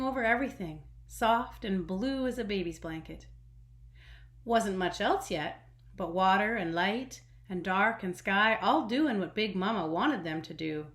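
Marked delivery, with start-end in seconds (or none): none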